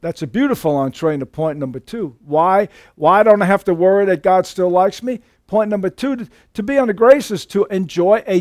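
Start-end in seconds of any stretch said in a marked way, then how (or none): none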